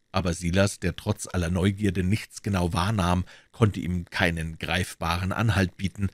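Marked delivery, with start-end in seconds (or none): none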